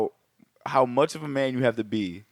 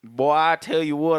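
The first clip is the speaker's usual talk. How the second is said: slow, lightly put-on Southern country accent; 3 semitones higher, slower than usual. Host imitates the cowboy-hat drug lord in a mild country accent. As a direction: drawl it like a Texas salesman, lightly. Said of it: "I'm trying to have him sound like Buck Strickland".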